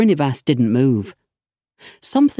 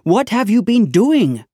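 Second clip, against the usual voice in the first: pitched-up angry demand; shouted; 7 semitones higher than usual. Acted. Inspector demanding answers from constable, higher angry shout. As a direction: snap the question up high. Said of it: "the inspector shouted".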